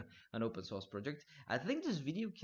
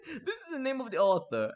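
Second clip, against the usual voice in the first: laughing; 13 semitones higher, 9 dB louder than usual. Real, not acted. Amused by robot-written article.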